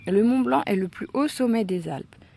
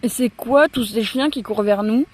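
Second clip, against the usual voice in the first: louder alarmed question, lightly played; a hint of panic at the running dogs; mock panic; 4 semitones higher, 7 dB louder than usual. Boy sees a pack of dogs charging, mild panicked question. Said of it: "panique Noah".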